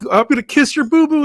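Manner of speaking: high, loud, goofy falsetto; over-the-top imitation of a doting visitor; mock babying voice. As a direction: coo it loudly in a squeaky voice. Voices Mankind babying Vince.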